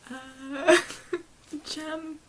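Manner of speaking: soft choked sobbing voice; barely holding back tears; crying. Real, not acted. Moved by Jem's violin, crying softly. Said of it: "now I'm really crying".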